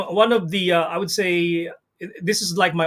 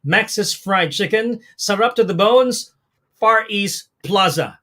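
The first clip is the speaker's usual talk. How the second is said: slow, deliberate; slower than usual. Shown as a demonstration.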